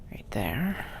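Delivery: soft whisper to herself. Real, not acted. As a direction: whisper it under the breath. Whispers while finding piece.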